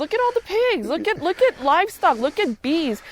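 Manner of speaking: high-pitched, impassioned listing; real excited insistence, way up high; worked-up insistence. Real, not acted. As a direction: pile up examples, pitch soaring. Heated list of examples.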